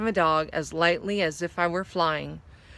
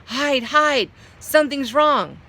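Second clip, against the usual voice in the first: high, loud panicked cry; panicked warning; 7 semitones higher, 5 dB louder than usual. A narrator's reading. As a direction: warn her in a frightened rush. Guest warns in panic.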